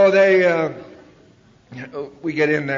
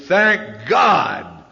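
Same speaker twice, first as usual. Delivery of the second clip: relieved exclaim; 3 semitones higher, 8 dB louder than usual. Retold or imitated. Relieved about getting drink.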